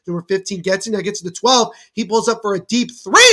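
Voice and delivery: brisk live game call, rising on the big plays; lively sports commentary. Acted. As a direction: call the action as it happens.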